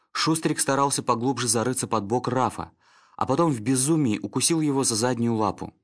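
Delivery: usual speaking voice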